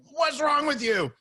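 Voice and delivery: drawn-out, high-pitched indignant question; imitating an incredulous dealer, voice jumping up; affronted outburst. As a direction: act out a car dealer taken aback. Retold outraged car dealer, calm reply.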